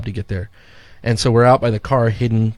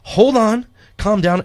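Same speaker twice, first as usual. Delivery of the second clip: calming friend; 10 semitones higher, slower than usual. Retold or imitated. Disler calming him down before the costume, higher and urgent. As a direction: hold him back from bolting.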